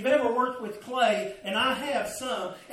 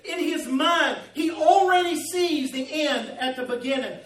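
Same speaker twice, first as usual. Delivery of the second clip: raised, high preaching voice, building; impassioned preaching; 4 semitones higher, 3 dB louder than usual. Real, not acted. Preacher telling how the master shapes clay, fervent preaching. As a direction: drive it forward with rising fervor.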